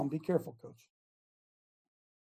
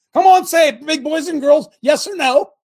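loud, higher call demanding an answer; shouting challenge; 7 semitones higher, 9 dB louder than usual. Real, not acted. Coach shouting at his listeners to answer. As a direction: shout it out to the room.

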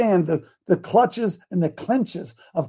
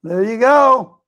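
usual speaking voice

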